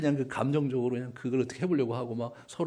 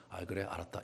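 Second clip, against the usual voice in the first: soft, much quieter, resigned yes; giving in; 7 semitones lower, 13 dB quieter than usual. Pretend. Preacher voicing God giving in to Moses, soft and resigned. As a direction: concede gently like a softened parent. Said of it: "이렇게 얘기하시는 분이 이분이요".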